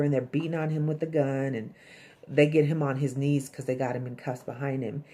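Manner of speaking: ordinary talk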